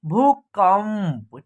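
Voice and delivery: भूकंप slow, with long भू and held म before प; drawn-out pronunciation. Shown as a demonstration. Teacher showing how भूकंप is pronounced, slowly drawn out. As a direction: stretch भूकंप, humming the half म. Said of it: "तो इसका उच्चारण हो जाएगा".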